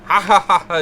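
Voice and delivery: high, loud character laugh; acted laughing fit. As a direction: burst out in put-on laughter. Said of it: "He's just laughing over and over".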